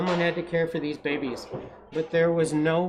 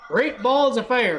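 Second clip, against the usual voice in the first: higher, louder exclamation; crying out; 7 semitones higher, 6 dB louder than usual. A narrator's reading. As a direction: blurt it out in alarm. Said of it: "cried the goose".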